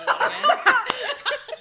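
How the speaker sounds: laughing shout. Real, not acted. Reacting to a cringe greeting.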